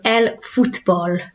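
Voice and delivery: el fútbol said slowly with a pure Spanish u. Shown as a demonstration. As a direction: say el fútbol with a short oo in fút. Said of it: "it's not foot, it's foot".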